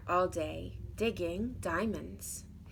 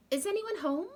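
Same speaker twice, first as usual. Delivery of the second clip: higher sweet calling voice; 10 semitones higher, 3 dB louder than usual. Acted. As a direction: call gently into the house. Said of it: "she called".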